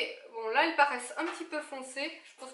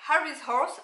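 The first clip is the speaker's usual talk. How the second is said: brand name "Harry's Horse" in a hammed-up English accent; a light, playful English accent on the brand name; mock English accent; 3 semitones higher, 6 dB louder than usual. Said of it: "admirez mon magnifique accent".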